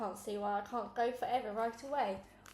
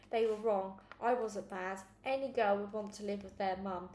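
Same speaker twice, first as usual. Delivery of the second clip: ordinary delivery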